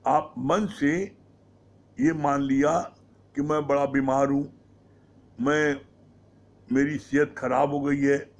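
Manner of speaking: ordinary delivery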